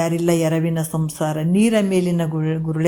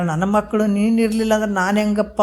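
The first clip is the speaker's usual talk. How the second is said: normal talking voice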